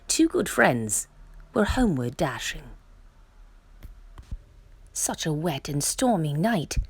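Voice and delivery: hushed bedtime storybook voice; bedtime story narration. A narrator's reading. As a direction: tell it gently like a picture book at bedtime.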